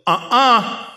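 high, loud "uh-uh"; sharp warning to the dog. Retold or imitated. Pastor warning his collie as the sheep passes, high and sharp. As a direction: stop her with one sound.

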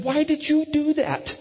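high, drawn-out playful reproach; mock accusation. Dad questioning his laughing son, high mock accusation. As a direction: demand it slowly in a high pitch.

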